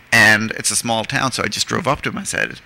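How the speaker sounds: normal talking voice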